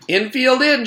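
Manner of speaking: high, loud call to the fielders; a playful shout from Damien. A narrator's reading. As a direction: yell it across the field. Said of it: "shouted Damien".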